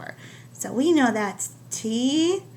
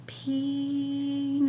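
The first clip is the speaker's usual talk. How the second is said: stretched slow word; teacher sounding out a word slowly; 2 semitones higher, 5 dB quieter than usual. Shown as a demonstration. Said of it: "I gotta say it really slow".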